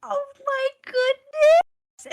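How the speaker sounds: high, drawn-out delight; thrilled, stretched-out squeal of words; delighted gasp-like exclamation. Acted. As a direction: gush it slowly and very high. Delighted reaction to gift.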